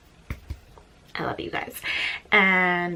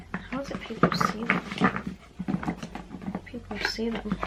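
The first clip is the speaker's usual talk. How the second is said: slow, hushed repetition; drifting, absorbed murmur; murmured to self; 6 dB quieter, slower than usual. Real, not acted. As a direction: ask the cards slowly under your breath. Murmuring the question over the cards.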